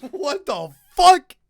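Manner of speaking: high, slow disbelieving squeal; shocked laugh. Real, not acted.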